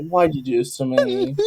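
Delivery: ordinary delivery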